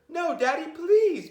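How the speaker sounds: put-on very high, pleading child voices; begging kids. A narrator's reading. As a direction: beg Daddy like small children. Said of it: "begged Betty and Billy".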